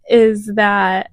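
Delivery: ordinary talk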